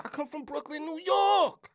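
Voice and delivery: high, building to a loud cry; hometown shout. Real, not acted. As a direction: proclaim your hometown, blasting the final words. Proudly proclaims his hometown.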